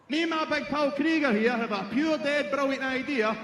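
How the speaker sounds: ordinary delivery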